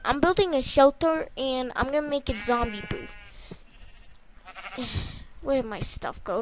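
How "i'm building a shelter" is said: ordinary talk, nothing marked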